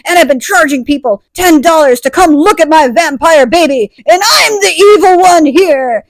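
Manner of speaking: high, loud hammed-up villain; evil villain reveal. Jenna reveals herself to townspeople, loud mock villain. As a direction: proclaim it loudly as a gloating mock villain.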